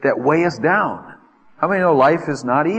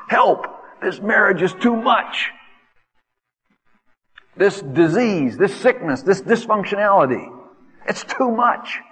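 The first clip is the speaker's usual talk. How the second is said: desperate cry; 6 semitones higher than usual. Acted. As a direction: pile up grievances, crying out to God. Voicing a believer crying out.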